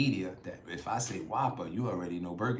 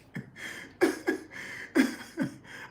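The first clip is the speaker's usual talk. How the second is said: high, airless giggle he can't hold back; cracking up at a viewer's comment; breathless burst of laughter. Real, not acted. Laughing at the facial hair comment, breathless burst. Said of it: "I'm sorry, y'all".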